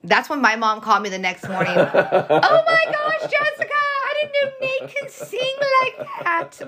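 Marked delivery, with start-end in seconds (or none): 1.8-6.7 high, slow, gushing mom voice; mom impression. Daughter mimicking mom's phone call, high and gushing. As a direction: squeal it out like an excited mom. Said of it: "My mom does not sound like that".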